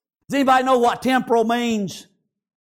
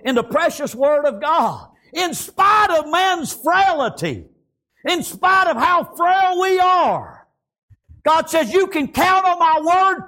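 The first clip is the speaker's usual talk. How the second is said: impassioned, raised sermon delivery; fervent preaching; 7 semitones higher than usual. Real, not acted. Preacher telling the congregation God never lets you down, fervent preaching. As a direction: drive each phrase home to the congregation.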